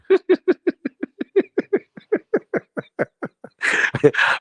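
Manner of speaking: long, high-pitched cackle; long high laugh. Real, not acted. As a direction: burst into a long delighted laugh. Laughs at his own story.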